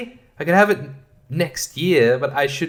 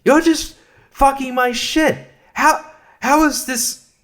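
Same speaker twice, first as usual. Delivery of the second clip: higher, slow, exasperated disbelief; exasperated rant; 8 semitones higher, slower than usual. Real, not acted. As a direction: drag it out in outraged disbelief. Ranting at Bing, slowed.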